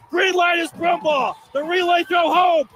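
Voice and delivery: ordinary delivery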